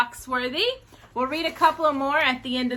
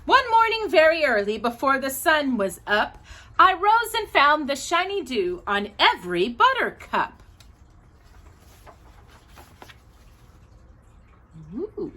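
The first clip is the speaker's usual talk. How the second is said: put-on sing-song poem reading for children, lilting; reading a poem aloud. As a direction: read it like a bedtime poem to little kids.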